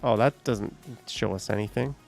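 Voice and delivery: usual speaking voice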